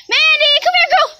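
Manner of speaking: very high, loud summons; dog call. Real, not acted. Owner calling the dog over, high loud call.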